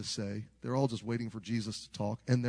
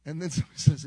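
normal talking voice

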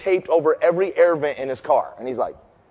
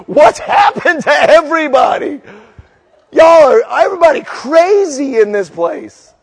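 high, loud comic bewilderment; exasperated shout; 7 semitones higher, 8 dB louder than usual. Retold or imitated. Preacher between warring church factions, exasperated shouting. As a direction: cry out at a world gone crazy. Exasperated outburst at both sides.

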